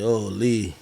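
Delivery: ordinary talk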